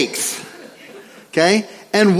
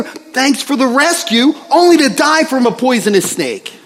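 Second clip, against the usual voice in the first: mock angry yelling; 6 semitones higher than usual. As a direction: pretend to lose it, shouting at God. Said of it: "and he starts yelling".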